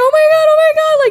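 high, loud gushing; excited squeal. Retold or imitated. Mom greeting Carlo at the door, loud and high. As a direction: gush like you can't believe it.

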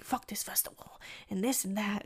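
whispered griping, voice dropped right down; hushed swearing. Retold or imitated. Leaving the festival, griping with a friend, whispered. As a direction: mutter it under your breath.

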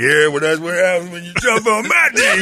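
high, hollered mock bragging; boastful boyfriend impression. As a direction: crow loudly like a proud lover. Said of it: "And he's like".

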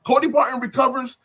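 high, loud fan excitement; excited outburst. Real, not acted. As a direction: burst out with the big news. Excited fan recounting the fumble recovery.

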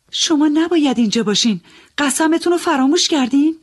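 high, urgent scolding, a little fast. Acted. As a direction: reproach him sharply for breaking his oath. Narrator scolding the young man.

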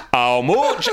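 broad northern "How much?" rising at the end; northern accent. Retold or imitated. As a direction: put on a northern holidaymaker. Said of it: "best northern accent I've ever heard".

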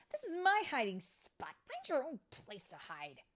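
high, loud outburst easing off; indignant scolding. Acted. Vidia to Tinkerbell in her hiding spot, indignant. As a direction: shoo off an intruder.

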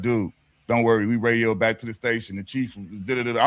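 low, settled imitation of a cop; reassuring officer. Officers telling driver not to worry, low reassurance. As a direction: drop the pitch, take him into confidence.

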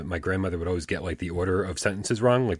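ordinary talk, nothing marked

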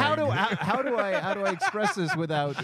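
laughing, loud and flustered. Real, not acted. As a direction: fumble for words through laughter. Laughing, flustered about accent.

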